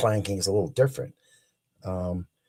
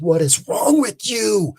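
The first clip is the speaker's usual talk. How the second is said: high, slow, puzzled chief; chief's alarmed question; 13 semitones higher, 6 dB louder, slower than usual. Retold or imitated. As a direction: ask slowly, pitched up in disbelief. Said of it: "he looked at me and says".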